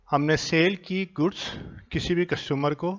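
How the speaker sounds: ordinary talk, nothing marked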